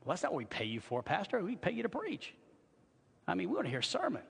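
lightly mimicked complaint, pitch climbing; objecting churchgoer. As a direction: put on a mild protesting congregant. Voices an objecting congregation member.

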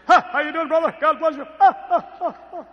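booming, high-pitched mock preacher; hammed-up pulpit greeting with loud huffs; fake preacher voice. Preacher changing his voice on you, mock-pious boom. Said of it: "when they change their voice on you".

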